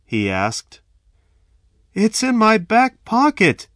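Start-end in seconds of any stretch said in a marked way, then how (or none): 1.9-3.7 high, loud nervous answer; frightened. A narrator's reading. Victim telling robber where wallet is, scared.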